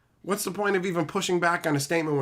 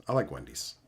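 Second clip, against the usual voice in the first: much lower, softer, mock-serious; deep put-on voice; 8 dB quieter than usual. Answering Petey about Wendy's in a deep goofy voice. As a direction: drop into a low silly voice for "I like Wendy's". Repeats line in two voices.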